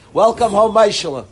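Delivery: hearty greeting to a student walking in; loud welcome. Real, not acted. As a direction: call out the welcome across the room. Greeting a returning student aloud.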